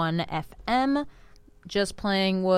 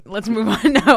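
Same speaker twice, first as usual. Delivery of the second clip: laughing; 4 semitones higher, 11 dB louder than usual. Real, not acted. Laughs at own silly fact.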